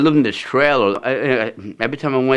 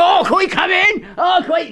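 very high pleading hillbilly holler; begging hillbilly. Retold or imitated. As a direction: whine to be let in, high.

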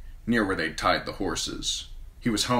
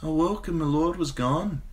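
sleepy murmur; 10 semitones higher than usual. A narrator's reading. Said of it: "murmured sleepily".

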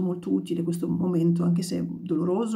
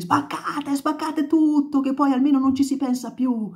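bitter shout; 7 semitones higher, 3 dB louder than usual. Acted. Redenta crying out as a rocket shakes the windows. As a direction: dare them to smash it all.

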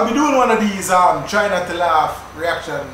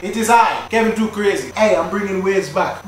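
ordinary talk

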